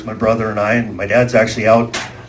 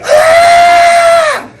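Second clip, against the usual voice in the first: loud, very high-pitched imitated scream; 7 dB louder than usual. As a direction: mimic a panicked scream at full volume. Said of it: "I hear this screaming".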